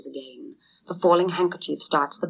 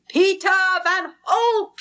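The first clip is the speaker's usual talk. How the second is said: high, loud, slow cheer; crowd shout; 14 semitones higher, 4 dB louder than usual. A narrator's reading. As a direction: roar it like a whole crowd. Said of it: "shouted a hundred voices".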